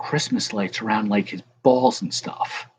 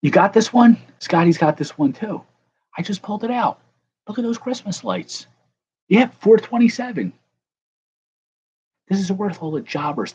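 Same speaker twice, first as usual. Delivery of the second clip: much higher, louder, thrilled and showing off; excited; 10 semitones higher, 5 dB louder than usual. Real, not acted. Showing off rare hit card.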